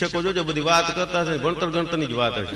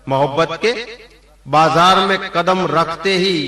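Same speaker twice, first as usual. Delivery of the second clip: shayari recital; 2 semitones higher, 7 dB louder, slower than usual. Acted. Repeating the couplet line for effect.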